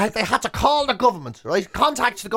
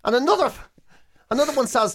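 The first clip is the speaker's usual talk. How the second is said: ordinary talk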